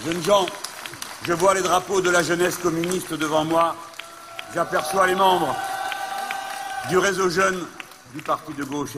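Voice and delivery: loud, high rally oratory; ranting dictator speech. Real, not acted. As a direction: proclaim it to the cheering crowd. Rally speech at full force.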